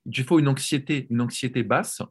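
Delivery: usual speaking voice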